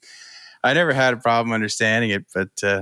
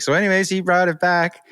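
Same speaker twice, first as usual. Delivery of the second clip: raised-pitch retold voice of a trucker; imitating cousin; 8 semitones higher than usual. Retelling a trucker's remark about dirty trucks, higher imitated voice. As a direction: lift the voice to mimic the other driver. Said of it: "he's like".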